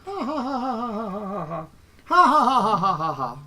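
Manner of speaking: stagey, rising and falling mock laugh; hammed-up laugh. Dad hamming up a villain laugh on camera. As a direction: cackle it out like a cartoon villain.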